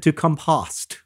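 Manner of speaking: "compost" said as com-POST; verb-style stress. Retold or imitated. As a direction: stress the second syllable of "compost". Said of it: "verb has the stress on the second".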